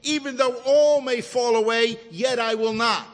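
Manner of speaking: loud, high, emphatic boast; boastful. Retold or imitated. Peter boasting of his loyalty to Jesus, loud and boastful. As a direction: proclaim it loudly like a proud vow. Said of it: "Peter boasted".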